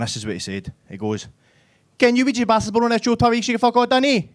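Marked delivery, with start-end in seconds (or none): 2.0-4.3 high, loud mock Dundee accent. Dundee guy approaches electrician on building site, mock Dundee accent. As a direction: rattle it out in an impenetrable Dundee squawk. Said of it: "they've got a weird accent up there".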